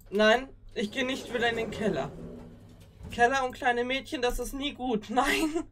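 loud, high, scared refusal; panicked protest. Real, not acted. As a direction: refuse in real panic, loudly. Refuses to go to cellar.

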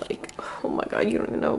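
soft gushing whisper. Real, not acted. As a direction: breathe it out, delighted. Gushing about her shirt.

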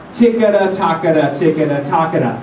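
crisp ticketa takata, light and quick; triple tonguing syllables. Shown as a demonstration. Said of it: "Repeat after me".

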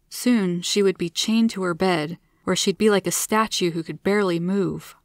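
ordinary delivery, nothing marked

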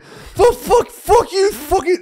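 very high, loud sputtering curses; stuttering rage. Retold or imitated. Storyteller acting out his spaz, high stuttering fury. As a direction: sputter the swear word, re-enacting a total freak-out. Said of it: "I'm, like, stuttering".

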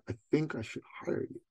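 quiet, unsure little ask; softly floating the request to the coach; tentative. Retold or imitated. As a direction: say it hesitantly, barely daring. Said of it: "I kinda tentatively said".

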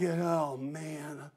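soft, low groan trailing into a heavy sigh; weary groan. Acted. Preacher acting out Jesus groaning at the tomb, soft weary groan. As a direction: groan it under your breath, then sigh. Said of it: "groaning in himself".

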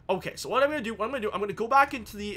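normal talking voice

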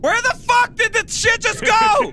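alarmed yell; shouting. Real, not acted. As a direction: shout it in panic. Panicking as the anchor flies off.

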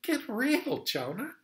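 high, mocking disbelief; exasperated parent rebuke; God incredulous. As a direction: tell him to get real. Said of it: "And God's going".

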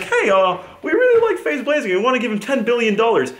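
high-pitched mock company spokesperson; put-on high voice for a corporate offer; imagined Sony representative. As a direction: pitch it up high, like an eager sponsor making an offer. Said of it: "Sony ever comes to me and says".

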